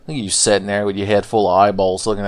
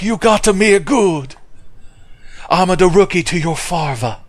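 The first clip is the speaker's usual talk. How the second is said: mad Miyazaki; 8 semitones higher than usual. Retold or imitated. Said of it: "He's like".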